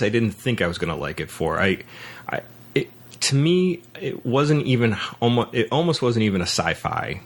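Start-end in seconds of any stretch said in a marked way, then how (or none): none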